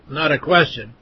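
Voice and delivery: ordinary delivery, nothing marked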